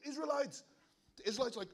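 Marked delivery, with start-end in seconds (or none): 0.0-0.6 warriors charging. Retold or imitated. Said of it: "sends all his warriors".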